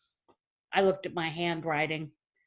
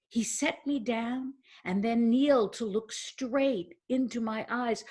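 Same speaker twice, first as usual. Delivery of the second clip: usual speaking voice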